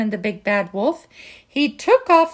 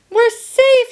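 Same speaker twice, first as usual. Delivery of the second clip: much higher, loud cheer; joyful shout; 12 semitones higher, 8 dB louder than usual. Acted. Three pigs cheering their escape, loud and high. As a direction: shout it out in relief. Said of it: "shouted the three little pigs".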